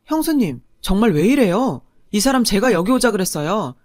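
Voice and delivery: put-on loud, indignant young man snapping at his sister-in-law; angry outburst. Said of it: "시동생이 저한테 화를 낸 건".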